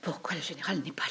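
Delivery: soft, half-whispered question; hushed. Acted. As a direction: keep it low at a sickbed. Hushed talk at sickbed. (à voix basse, presque chuchoté)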